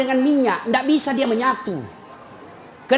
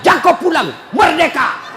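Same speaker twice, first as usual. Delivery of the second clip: mock angry wife; 7 semitones higher, 7 dB louder than usual. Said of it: "Maka dia bilang".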